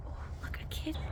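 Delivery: hushed whisper so the cat isn't startled; soft whisper on spotting a kitty. Real, not acted. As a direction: breathe it out softly. Spots a cat on walk.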